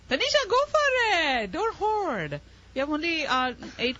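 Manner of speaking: playful high-pitched coaxing; urging on. Real, not acted. Quizmaster urging a contestant on.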